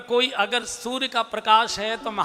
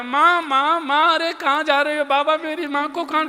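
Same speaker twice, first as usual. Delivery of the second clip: put-on high, pleading child calling after his mother; Rohit, bambino disperato; 6 semitones higher, 4 dB louder than usual. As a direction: cry out like a little boy running after his mother. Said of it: "पीछे पीछे रोहित दौड़ता है".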